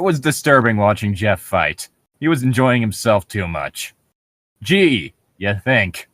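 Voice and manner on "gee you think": normal talking voice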